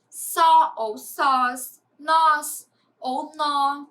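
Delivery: "só ou sós, nós ou nó" drawn out word by word; teacher modelling monosyllables very slowly; very slow demo. Shown as a demonstration. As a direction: stretch each syllable to show the stress. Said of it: "Observem esses exemplos a seguir".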